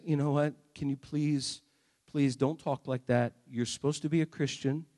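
low, soft, restrained scolding; quiet mock reproof. God imagined correcting a believer's talk, quiet mock reproof.

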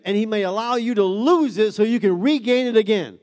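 high, loud, driving sermon delivery; full-force preaching. Real, not acted. Preacher urging the congregation to get their voice back, fervent preaching.

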